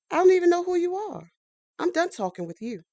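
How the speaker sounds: angry. Acted. Recruit on the phone, offended at being passed over, angry. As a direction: snap back at the stranger on the line.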